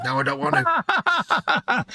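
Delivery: mum refusing. Retold or imitated. Carer retells mum stubbornly refusing her wash, whiny drawn out. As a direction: drag it out like a stubborn old mum.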